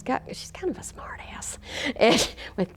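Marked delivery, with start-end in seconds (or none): 0.3-1.6 conspiratorial whisper; whispering a cheeky confidence; whispered aside. Real, not acted. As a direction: whisper it like a secret. Confiding aside about colleague.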